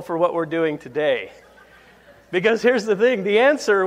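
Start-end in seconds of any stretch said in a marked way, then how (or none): 2.3-3.9 laughing. Real, not acted. Laughing while resuming.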